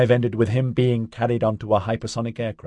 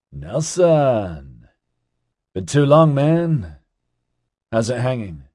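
drawn-out name, then higher and brighter greeting; happy greeting; 5 semitones higher, slower than usual. A narrator's reading. Ozzy greeting Nelson after too long, happy. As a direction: hail a long-lost buddy. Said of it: "Ozzy exclaimed happily".

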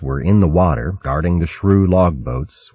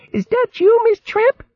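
very high little hedgehog maid; tiny laughing squeak; squeaky voice. Acted.

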